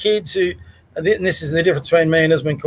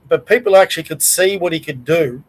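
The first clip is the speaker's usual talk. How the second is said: ordinary talk, nothing marked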